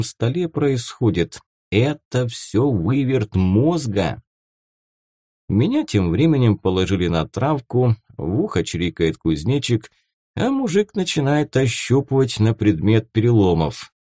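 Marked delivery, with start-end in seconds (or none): none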